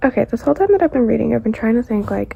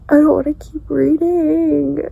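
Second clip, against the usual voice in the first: tearful; 5 semitones higher, 5 dB louder, slower than usual. Real, not acted. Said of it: "I'm not gonna cry".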